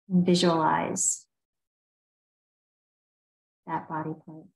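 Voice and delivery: unhurried meditation guide with long pauses; guided meditation. Real, not acted. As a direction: ease listeners through a body scan, leaving space between phrases. Leads a body scan meditation.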